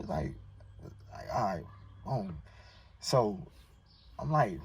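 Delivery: ordinary talk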